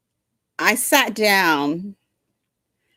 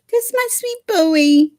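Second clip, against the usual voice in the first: very high, doting coo; 13 semitones higher than usual. Real, not acted. Cooing at her dog.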